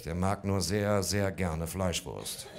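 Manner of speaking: slowed, drawn-out sehr, sehr gerne. Retold or imitated. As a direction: stretch the words to sell the excuse. Dragging out excuse.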